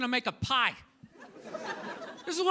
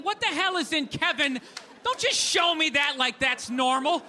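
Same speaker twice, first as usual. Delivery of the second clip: normal talking voice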